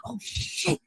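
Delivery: stunned breathy aside; hushed, voiceless disbelief; shocked whisper. Retold or imitated. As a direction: gasp it out in disbelief. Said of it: "And I'll be like".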